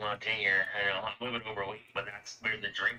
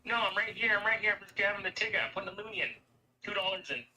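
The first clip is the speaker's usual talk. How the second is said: breathless, higher pleading call, lightly acted; out of breath; 10 semitones higher than usual. Racing back to the parking attendant, breathless pleading. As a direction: gasp it out, running back to the car. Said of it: "out of breath".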